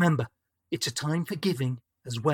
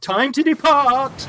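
high, loud, slow declamation; grand proclamation; 10 semitones higher, 9 dB louder, slower than usual. Acted. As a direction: boom it out like a spirit. Spirit's grand dramatic exit.

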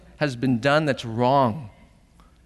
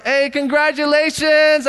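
high, loud, gushing congratulations; excited congratulations; 13 semitones higher, 6 dB louder than usual. Pretend. Congratulating Jordan at graduation with the money lay, excited.

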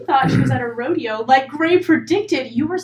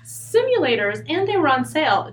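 usual speaking voice

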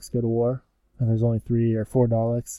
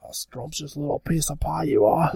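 higher-pitched, exaggerated Cockney; mock Cockney; 4 semitones higher than usual. Fake Doctor complimenting someone, mock Cockney. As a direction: lay the fake Cockney on thick. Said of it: "hardcore Cockney".

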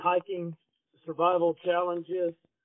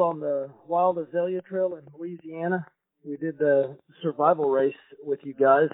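ordinary talk, nothing marked

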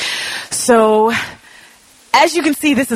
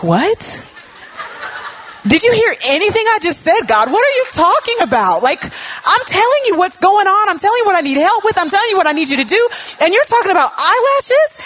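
incredulous protest; 11 semitones higher than usual. Retold or imitated. Retelling her flustered protest to God, high and incredulous. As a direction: squeak it out, baffled and fed up.

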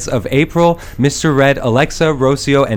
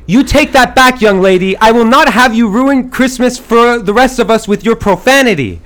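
loud, high, scolding shout; 6 semitones higher, 3 dB louder than usual. Acted. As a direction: yell it at her like a stern parent. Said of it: "and I shout".

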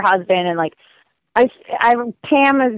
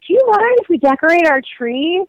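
put-on polite kids; 7 semitones higher than usual. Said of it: "And they're like".

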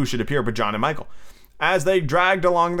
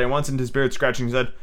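plain reading voice

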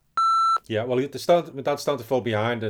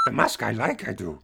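a light Yoda voice fading into mumble; lightly voiced Yoda. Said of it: "pervy part of pervy Yoda".

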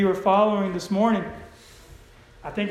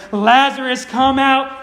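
raised, higher commanding call; loud cry; 5 semitones higher, 9 dB louder, slower than usual. Acted. Preacher reading Jesus calling into the tomb, loud cry. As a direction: call it out loudly, voice lifted. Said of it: "he cried with a loud voice".

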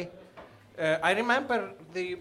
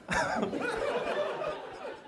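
embarrassed laugh; 6 dB quieter than usual. Real, not acted. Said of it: "I can't, I can't do it".

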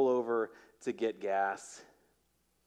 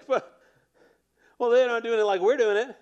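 much higher, louder, fast snobbish whine; mocking churchgoer; 10 semitones higher, 8 dB louder, faster than usual. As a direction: lampoon the smug congregation. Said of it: "we're like".

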